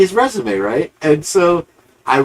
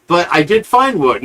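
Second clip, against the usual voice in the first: sarcastic impression of a grandstanding TV investigator; mocking the investigator; 6 semitones higher than usual. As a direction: ham up the fake-surprised investigator finding his prop. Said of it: "he did this whole".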